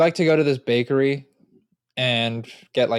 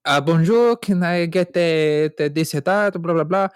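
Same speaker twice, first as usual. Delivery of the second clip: higher, louder mock French accent; French woman's accent; 3 semitones higher, 3 dB louder than usual. French woman ordering at the bakery, mock French accent. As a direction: imitate a French customer with a heavy accent. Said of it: "No, it was a French woman".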